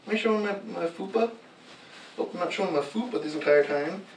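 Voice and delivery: normal talking voice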